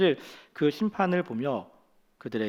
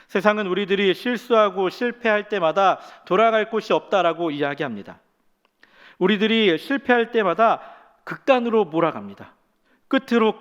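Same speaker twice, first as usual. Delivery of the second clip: higher, louder preaching climax; preaching at full force; 5 semitones higher, 5 dB louder than usual. Acted. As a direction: drive the point home with force. Sermon climax, building intensity.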